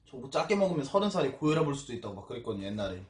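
talking with food in mouth; speaking between bites; chewing while talking. Real, not acted. Seen 0: Took a spoonful just before; cheeks look slightly full while speaking.

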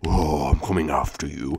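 very low, soft growl; deep monster taunt. Acted. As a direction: threaten from deep in the chest. Voicing the right leg.

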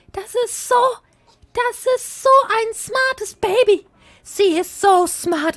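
put-on proud parent cooing over the smart baby, high and slow; doting baby talk. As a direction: gush over the clever baby.

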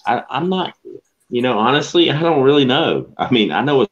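high, flustered admission. Real, not acted. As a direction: caught out, voice jumps up. Stumped by question, laughing.

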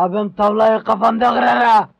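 low menacing growl; deep threat. Acted. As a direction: threaten him, low and hard. Henchman threatens opponent.

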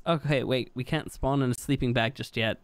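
normal talking voice

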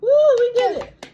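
high loud victory whoops; real joyful cheer. Real, not acted. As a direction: celebrate the win out loud. Cheering finished dish.

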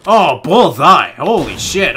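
loud, slow, savoured "bullseye"; thrilled exclaim. Real, not acted. Gamer to viewers after a perfect snipe, loud thrilled cheer. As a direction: relish the perfect shot.

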